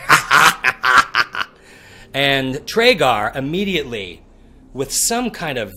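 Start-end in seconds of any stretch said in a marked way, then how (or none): 0.1-1.5 mocking orc laugh; a light, mock orc laugh. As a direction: orc mocks the old man's fall, lightly laughing. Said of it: "the orc goes".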